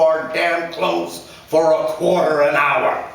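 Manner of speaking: a blustering father scolding his son; angry father. A narrator's reading. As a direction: lecture him like a bitter dad. Father rants about business.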